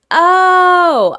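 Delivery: long, high, loud "oh" of understanding; big re-enacted lightbulb moment; dawning realization. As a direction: act out the penny dropping, drawn out and loud. Acts out sudden understanding of film.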